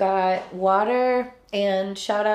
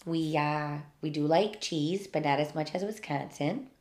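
put-on lower, softer Minnesota accent; 5 semitones lower, 7 dB quieter than usual. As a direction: play a mild-mannered Minnesotan. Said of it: "weird how accents are a thing".

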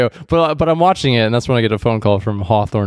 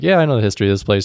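fast; much faster than usual. Retold or imitated. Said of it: "I'm like".

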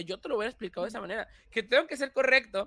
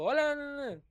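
bright youtuber intro, retaking; upbeat channel greeting, then scrapping it; mock video intro; reading a script aloud; 5 semitones higher, 6 dB louder than usual. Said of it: "leo un párrafo".

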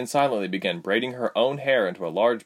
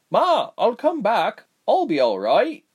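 high, slow reassuring reply; 13 semitones higher, 5 dB louder than usual. A narrator's reading. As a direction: comfort a worried mother. Reassuring worried mother.

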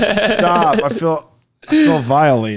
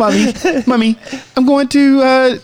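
put-on higher, childish joke Detroit accent; mock Detroit accent; 7 semitones higher than usual. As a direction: call to mummy in a fake Detroit accent. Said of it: "here's your Detroit accent".

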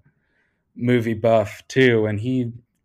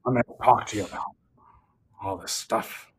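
Harrison Ford voice. Pretend. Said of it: "Harrison Ford just reading".

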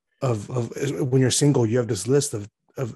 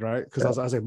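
ordinary talk, nothing marked

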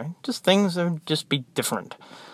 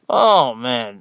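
ordinary talk

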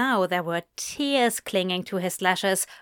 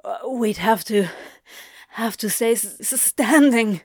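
breathless stammer; 5 dB louder than usual. A narrator's reading. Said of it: "His voice jumped up an octave".

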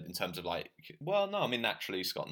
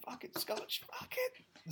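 muttered curse; 6 dB quieter, slower than usual. Real, not acted. Muttered slip of the tongue.

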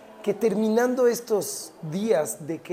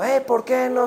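put-on mother yelling at her child, raised pitch; scolding yell; 6 semitones higher, 5 dB louder than usual. Mamá regaña al hijo, gritando. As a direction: shout it like an angry mother. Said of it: "le grita al hijo".

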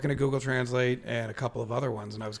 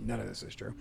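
ordinary talk, nothing marked

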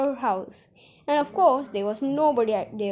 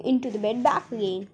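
plain reading voice

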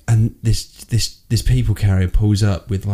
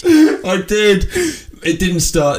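defensive protest; 10 semitones higher, much slower than usual. Real, not acted. Admitting the cheap sale to friend, high protest. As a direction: blurt it out in your own defence.

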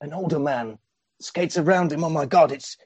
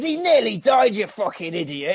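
mock angry yell; 7 semitones higher than usual. Brother quoted yelling.

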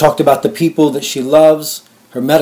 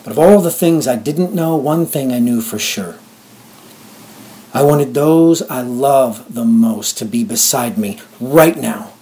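ordinary talk

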